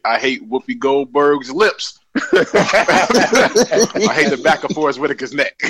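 slow, drawn-out mock hater; teasing parody of a grudge-holder; mocking co-host's rant. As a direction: lightly imitate a friend listing petty hates, breaking into laughter. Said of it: "You know what you sound like right now?".

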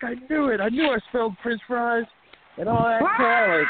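high mock outburst, climbing at the end; imitated freaked-out wrestler. Wrestler finding ketchup on his face, high mock outburst. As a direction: mimic his realization in a high voice.

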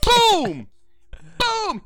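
loud, high "Boom!" mimicking a hand clap; imitated hard clap. Fan recreating the detective's clap, a loud high mock "Boom!". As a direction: slam out the clap sound. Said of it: "he goes like this".

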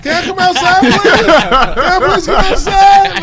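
put-on high, slow pleading child voice; whiny kid begging. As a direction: beg like a little kid. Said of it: "You was like".

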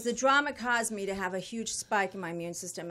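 normal talking voice

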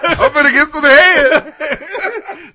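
high, loud, very slow laughing shout; gleeful shout. Pretend. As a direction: bellow it like a giddy cartoon killer. Voicing the killer, shouting.

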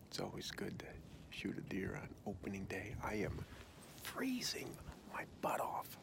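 hushed whisper while hunting. Real, not acted. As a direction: whisper so the deer won't hear. Whispering in a tree stand.

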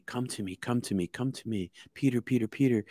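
hushed, repeated summons; soft beckoning voice. Retold or imitated. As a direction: call softly, as a mysterious voice would. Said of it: "the voice says to me".

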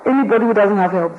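usual speaking voice

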